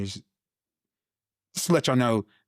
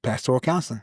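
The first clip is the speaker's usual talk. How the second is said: ordinary delivery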